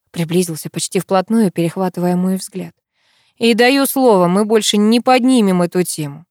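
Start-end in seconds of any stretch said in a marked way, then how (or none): none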